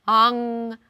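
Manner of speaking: 앙 with a long, held final ng; exaggerated word endings. Shown as a demonstration. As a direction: stretch the ng at the end of 앙. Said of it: "teacher models syllable again".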